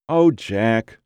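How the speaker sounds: mildly tearful phone voice; a touch of trembling upset; verge of tears. A narrator's reading. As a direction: hold back tears, lightly acted. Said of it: "Her voice trembled on the verge of tears".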